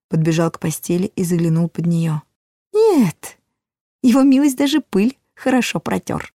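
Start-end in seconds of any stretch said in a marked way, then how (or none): 2.7-6.3 high little dwarf voice, amused; dwarf with a chuckle. A narrator's reading. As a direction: voice the dwarf with a smirk. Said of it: "сказал он со смешком".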